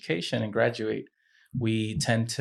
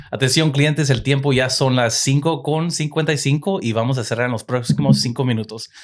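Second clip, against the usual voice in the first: loud, raised-pitch Spanish closing announcement; store announcement; 3 semitones higher, 5 dB louder than usual. Shown as a demonstration. Manager telling customers the store closes soon, loud announcer delivery. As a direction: project to the whole store like a radio announcer. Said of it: "it can sound, like, a little bit like this".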